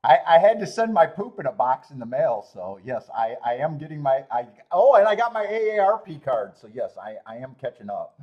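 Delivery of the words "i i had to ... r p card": half-shouted confession; loud. Real, not acted. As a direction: blurt it loudly. Loud confession about aging.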